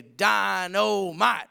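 drawn-out catchphrase, very slow; old TV show catchphrase. Retold or imitated. Preacher recalling an old show's catchphrase, imitated and stretched out. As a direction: stretch each syllable like a sitcom catchphrase. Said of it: "an old show where they say".

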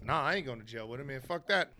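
fast, soft dismissive refusal; quick dismissive cop. Retold or imitated. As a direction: brush him off quickly. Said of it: "He goes".

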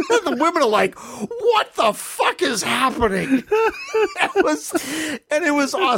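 high voice breaking with laughter; laughing. Real, not acted. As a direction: laugh through the words. Laughing through the punchline.

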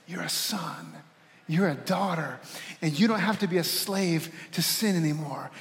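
soft, low reassurance; gentle voice of God. Acted. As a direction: speak tenderly as a loving father, low and hushed. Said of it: "His voice sounds more like this".

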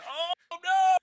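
high yelp rising to a loud no; hammed-up horrified oh no; mock cry of alarm. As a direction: squeal it like a cartoon victim. Mock cry as character hits.

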